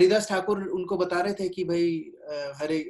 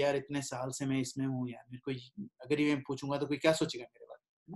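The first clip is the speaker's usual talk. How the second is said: much softer, ashamed murmur to himself; embarrassed self-talk; 4 semitones lower, 8 dB quieter than usual. Pretend. Old devotee too ashamed to ask his doubt, softer murmur. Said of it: "फिर शर्म आएगी".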